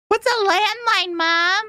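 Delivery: put-on high, wondering little child's question; imitating the five-year-old asking mom; curious kid. Streamer imitating her five-year-old asking mom, high childlike voice. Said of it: "the five-year-old".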